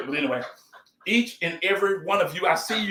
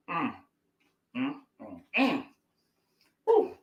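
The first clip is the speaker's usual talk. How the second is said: savoring mm; 4 dB quieter than usual. Real, not acted. Tasting the crunchy food.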